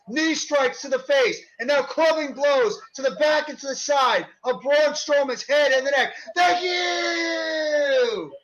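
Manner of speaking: rising, shouted ringside call; wrestling commentator. Acted. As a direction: build the hype move by move. Live play-by-play of match.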